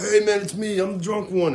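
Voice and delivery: high-pitched, eager mock call; retold drunken hail. Drunk caller flagging down the cops, mock eager hail. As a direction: lightly act out hailing someone.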